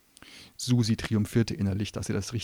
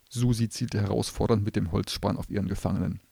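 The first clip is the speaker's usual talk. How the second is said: usual speaking voice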